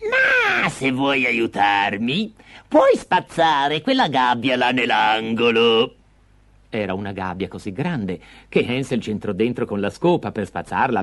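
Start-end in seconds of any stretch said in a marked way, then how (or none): 0.0-5.9 put-on slow, drawn-out old woman's voice; vecchia signora. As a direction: draw it out like an old crone. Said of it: "disse la vecchia signora". (voce lenta da vecchia)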